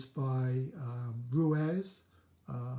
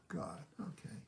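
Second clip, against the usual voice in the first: ordinary talk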